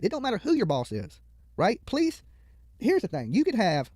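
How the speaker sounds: usual speaking voice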